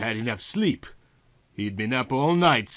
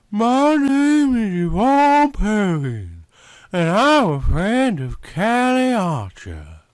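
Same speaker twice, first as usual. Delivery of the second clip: higher, slow Southern woman voice; Yvonne's voice; 8 semitones higher, 6 dB louder, slower than usual. A narrator's reading. Yvonne asking the detective his name, Southern and slow. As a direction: play her sultry and unhurried.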